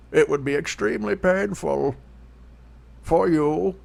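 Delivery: slow masked-villain menace; Bane voice. Pretend. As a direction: threaten slowly like Bane. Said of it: "I'm good at this".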